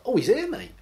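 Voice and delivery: cheeky local. Acted. A local breezily sends the seeker to the new priest, mock-casual. Acted local pointing visitor.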